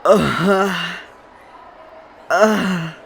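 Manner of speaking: high, drawn-out groan; hungover groan. Acted. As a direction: groan like waking up sick. Hungover, waking and about to puke.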